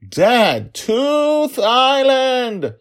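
very high, drawn-out yell; child's scream. Acted. Daughter screaming at her forgetful dad, exasperated. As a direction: scream it at the top, stretching the words. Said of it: "she screamed at him".

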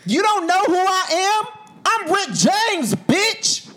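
high, loud, slow screaming celebrity brag; drawn-out hammed-up yell of the name. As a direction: yell it like a shouting superstar. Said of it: "That is not how he said it".

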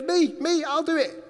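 high, keen, all in; can't wait to be picked; eager volunteering. Acted. Isaiah volunteering to God, high and keen.

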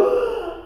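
high startled gasp; frightened gasp. Acted. Maria sees the dragon.

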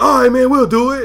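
high, loud mock whine; put-on high, put-upon agreement; grudging airmen. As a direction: give in like whiny juniors. Mimics grudging junior airmen.

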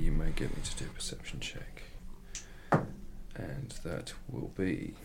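soft unvoiced whisper. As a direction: whisper it playfully. Joking dramatic whisper.

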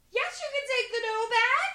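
high, loud, laughing permission; loud indulgent yes. Retold or imitated. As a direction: grant it loudly, half laughing. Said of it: "And I was like".

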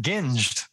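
"ginged" starting with G as in "give"; hard G. Shown as a demonstration. Host suggesting how some pronounce it, "ginged" with hard G. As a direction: offer "ginged" with a hard opening G. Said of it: "Is it a hard G?".

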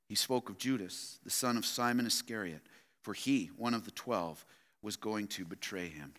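low, soft scripture reading; hushed, low-pitched Bible reading; solemn scripture reading. Acted. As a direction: read it out quietly and gravely. Reads scripture aloud to congregation.

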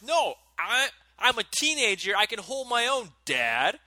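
put-on teen mouthing off to his dad, cocky and high; bratty teen defiance. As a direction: sass your father like a fourteen-year-old. Defiant teen talking back to dad.

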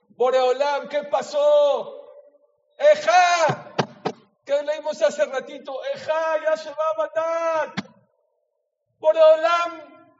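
shouting, high and loud, demanding where God is; angry lament to God. Retold or imitated. An angry mourner shouts his accusations up to God. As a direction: hammer each question at heaven in outrage.

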